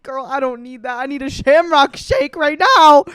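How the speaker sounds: rising to a loud, high sassy cry; building from sassy to a big comic shout; sassy outburst. Retold or imitated. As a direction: demand the Shamrock shake with comic gusto. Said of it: "I was like".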